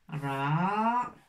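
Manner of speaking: usual speaking voice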